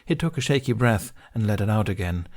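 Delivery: plain reading voice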